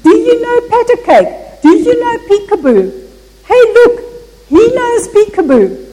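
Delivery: put-on high, loud-ish drunk's call across the restaurant; retold shouting. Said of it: "began shouting across the room".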